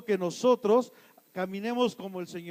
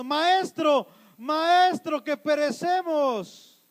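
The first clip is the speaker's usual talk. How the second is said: loud, higher cry for rescue; acted shouting; 5 semitones higher, 5 dB louder, much slower than usual. Disciples waking their teacher in the storm, desperate shout. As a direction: call out in mock desperation, dragging the last word. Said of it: "te la pases gritando".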